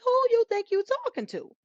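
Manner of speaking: put-on a mom snapping at her loud kids; mock scolding mom. Mother scolding her kids for getting loud, mock indignant. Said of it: "and I'm like".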